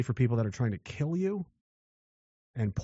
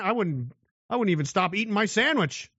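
ordinary delivery

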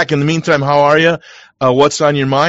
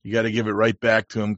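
ordinary delivery

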